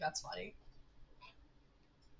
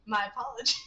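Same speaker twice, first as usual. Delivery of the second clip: usual speaking voice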